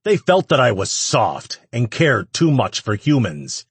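loud, high public proclamation; announcing. A narrator's reading. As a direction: address a whole crowd. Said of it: "Ash announced".

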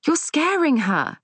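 high-pitched, urgent reproof; hard voice. A narrator's reading. Daughter protecting her frightened gran, hard-voiced. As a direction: protest sharply, pitch soaring then dropping. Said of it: "Safi's voice is hard".

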